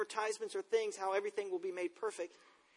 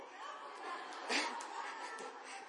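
breathless laugh; 4 semitones higher, 11 dB quieter than usual. Real, not acted. Laughs at son's quote.

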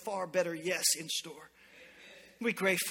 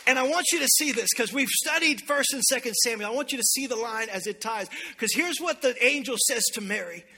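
loud, raised sermon voice; emphatic preaching; 5 semitones higher, 6 dB louder than usual. Real, not acted. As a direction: preach it with urgency. Preaches at raised pitch and volume.

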